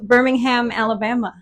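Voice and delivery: Birmingham with a full "ham" ending; American pronunciation of Birmingham. Shown as a demonstration. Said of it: "Before I would say Birmingham".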